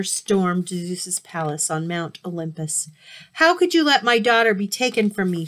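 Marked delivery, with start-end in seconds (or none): 3.3-5.5 loud, high, furious demand; raging. Acted. Angry mother Demeter confronts Zeus, loud and furious. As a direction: rage at Zeus for letting her daughter go. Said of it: "she raged".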